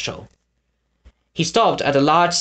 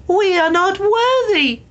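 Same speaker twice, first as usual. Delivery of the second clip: woman crying out; slower than usual. A narrator's reading. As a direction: swoon out the words in awe. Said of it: "cried a woman".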